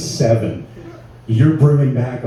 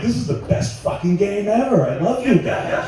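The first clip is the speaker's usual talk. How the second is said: high-pitched, giddy delight; gleeful delight; 8 semitones higher than usual. Acted. Stoner delighted by clueless airport security, gleeful. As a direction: gush with mock affection, pitched high.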